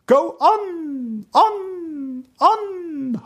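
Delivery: high, long-held ringing echo. A narrator's reading. Echo answering the prince in English, high ringing echo. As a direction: let each repeat ring out and fade. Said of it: "hallte es".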